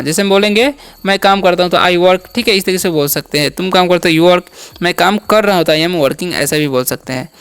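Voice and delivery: usual speaking voice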